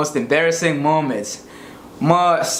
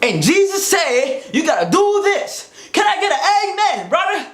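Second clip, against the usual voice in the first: much higher, fired-up preacher call; African pastor preaching; 13 semitones higher than usual. Retold or imitated. African pastor calling to the church, fired-up preaching. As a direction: rouse the congregation to shout amen. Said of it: "this is how pastors preach".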